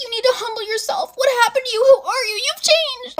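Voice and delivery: very high, mocking imitation of friend; mock upset. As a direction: mimic her getting dramatically upset. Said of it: "she gets upset".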